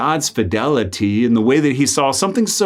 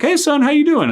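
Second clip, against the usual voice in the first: much higher, bright happy father greeting; cheerful dad. Retold or imitated. Dad calls his son with good news, beaming and high. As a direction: sing out the hello like a happy dad. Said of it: "He was like".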